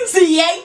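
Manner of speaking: siete said with a lilting Spanish rise. Shown as a demonstration. Counting in Spanish to her viewers, siete said with a rise. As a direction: roll siete out with a rising tune. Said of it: "Y'all heard how I said siete".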